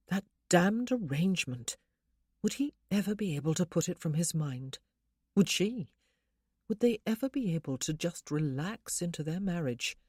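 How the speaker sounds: ordinary delivery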